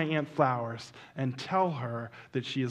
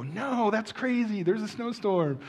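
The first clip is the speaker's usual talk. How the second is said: drawn-out, higher-pitched mock protest; dismissive protest; 7 semitones higher, slower than usual. Husband imagines his wife talking him out of it, dismissive protest.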